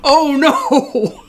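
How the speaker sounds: high, loud wail of dismay; dismayed outcry. Real, not acted. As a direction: cry out in horrified, over-the-top guilt. Alarmed at forgetting to feed animals.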